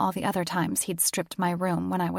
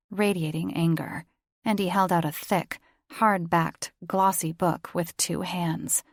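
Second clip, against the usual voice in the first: plain reading voice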